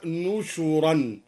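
نشرا said slowly as "nushuran"; damma on nun and shin. Shown as a demonstration. Teacher shows al-Duri's word, نشرا slowly as "nushuran". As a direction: give نشرا a u after both n and sh. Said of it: "وضم الشين أيضًا هكذا".

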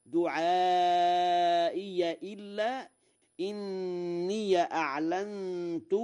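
دعائي with an open ya, duʕāʔiya; slow recitation of دعائي ending in -ya; Warsh reading. Shown as a demonstration. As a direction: intone دعائي إلا with fatha on the ya. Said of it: "بفتح الياء وصلا".

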